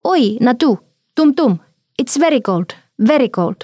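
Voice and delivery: put-on high-pitched little child's voice; child's exclamations. A narrator's reading. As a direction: exclaim like a small child, in short bursts. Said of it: "Hän huudahteli".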